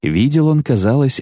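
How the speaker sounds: plain reading voice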